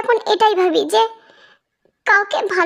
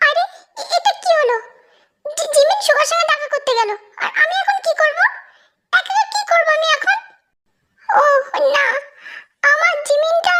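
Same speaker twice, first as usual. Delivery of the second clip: mock forlorn; 7 semitones higher than usual. Tae alone, high voice.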